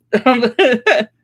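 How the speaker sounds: short high self-conscious laugh; embarrassed laugh. Real, not acted. Author asking listeners to rate her book, laughing. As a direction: laugh at your own writing.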